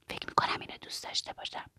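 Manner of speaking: very soft, voiceless whisper; whispered confiding. A narrator's reading. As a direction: whisper it like a shy admission. Continuing the hushed secret conversation. (نجوای خیلی آهسته)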